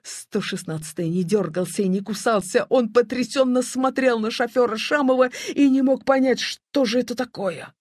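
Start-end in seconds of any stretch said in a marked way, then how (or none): none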